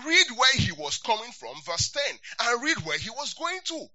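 loud, driving emphasis on read; forceful sermon emphasis; emphatic preaching. Real, not acted. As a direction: preach it out, high and strong. Preacher stressing key instruction.

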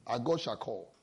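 a soft, low murmur of "our God shall call"; quiet echo. Shown as a demonstration. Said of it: "He put an emphasis".

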